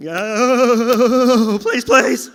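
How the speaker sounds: high, very loud panicked plea; screamed begging. Shown as a demonstration. Comic showing his move when attacked in the dark, screamed begging. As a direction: wail and beg for mercy. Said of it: "I fall to my knees and I scream".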